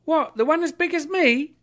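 put-on high, loud boyish astonishment; very high young voice; street boy. A narrator's reading. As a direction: answer as an amazed lad. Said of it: "returned the boy".

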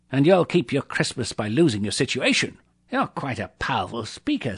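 understated miser's anger; threatening. A narrator's reading. Scrooge warning the clerk who applauded, threatening.

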